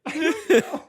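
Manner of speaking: very high, loud, laugh-choked squeal; helpless laughter. Real, not acted. Storyteller answering his friend, unable to stop laughing. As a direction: squeal it out between bursts of laughter.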